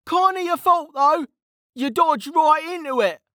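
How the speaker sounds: high-pitched character voice, protesting; cheeky young mage. Acted. Mage blaming the officer he hit, high cheeky shout. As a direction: shift the blame in a high voice. Kettle argues back mid-battle.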